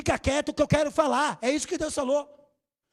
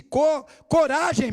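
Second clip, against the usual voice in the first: loud, higher, slow call of courage; a raised, drawn-out Co-, coragem; 5 semitones higher, 6 dB louder, much slower than usual. Retold or imitated. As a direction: stretch out coragem over the storm. Jesus calls across the storm.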